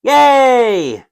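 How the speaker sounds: high, drawn-out yay; mock crowd cheer. Solo player voices the cheering stadium, mock cheer. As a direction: play the whole stadium cheering in one long high cry.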